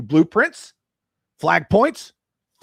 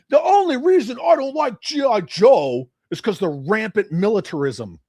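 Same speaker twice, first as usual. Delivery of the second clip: higher, louder mock critic voice; 5 semitones higher, 4 dB louder than usual. Host mimicking G I Joe critics, mocking voice. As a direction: sneer at the critic's complaint.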